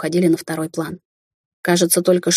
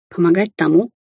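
usual speaking voice

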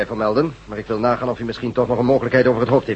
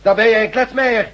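angry scolding; 8 semitones higher, 3 dB louder than usual. Acted. Chief scolds radioman.

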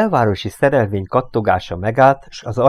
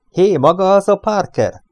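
higher, louder shout to someone; calling out; 10 semitones higher, 5 dB louder than usual. A narrator's reading. Driver hailing a man from the taxi, calling out. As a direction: call across to the man. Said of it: "kiáltott a fekete férfinak".

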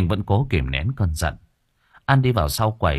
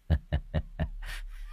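low breathy evil chuckle; sinister chuckle; 4 semitones lower, 11 dB quieter than usual. Acted. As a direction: snicker under the breath. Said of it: "Hắn cười hình hạch".

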